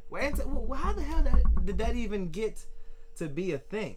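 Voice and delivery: high, loud disbelief; outraged how-could-that-be; incredulous. Real, not acted.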